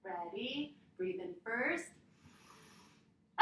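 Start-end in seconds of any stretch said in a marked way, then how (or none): 3.4-3.4 sharp loud yell; short scream. Shown as a demonstration. Yoga teacher screams with the kids like a bobcat. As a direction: let out a quick scream. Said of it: "let out a scream".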